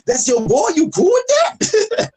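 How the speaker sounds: high-pitched words squeezed through laughter; laughing so hard the voice shoots up; helpless laughter. Retold or imitated. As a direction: tease them while cracking up. Teasing through a laughing fit.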